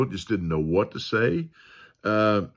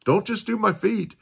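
much higher, eager burst; excited Peter; 7 semitones higher than usual. Retold or imitated. Peter begging Jesus to wash his head too, excited. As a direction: gush with over-the-top zeal. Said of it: "so excited".

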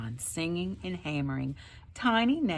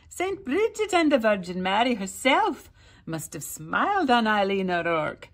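higher, louder gossiping village woman; lightly voiced chattering neighbour; 5 semitones higher, 5 dB louder than usual. A narrator's reading. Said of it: "the village women said".

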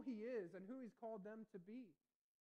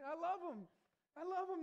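much higher and louder, beaming; joyful exclamation; 9 semitones higher, 9 dB louder than usual. Real, not acted. Preacher exclaims love for kids.

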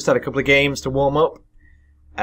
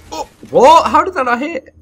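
high-pitched, loud disbelief; outraged high yelp; incredulous outburst; 10 dB louder than usual. Real, not acted. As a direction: squawk in protest at a missed shot. Shot misses unexpectedly.

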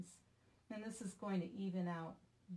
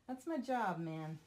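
mock indignant; 7 dB louder than usual. Real, not acted. Retorting to husband.

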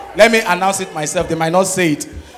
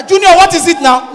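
high, louder demanding question; scolding mother; 9 semitones higher, 4 dB louder than usual. Retold or imitated. Mother scolding her son, high and sharp. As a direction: demand an answer from the child.

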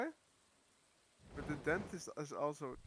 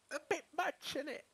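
higher, put-on English accent; 12 semitones higher than usual. Agreeing about costs in put-on English accent. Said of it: "English accent there".

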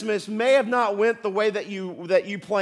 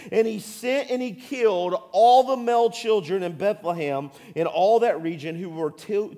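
normal talking voice

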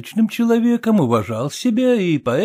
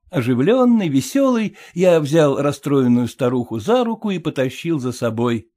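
usual speaking voice

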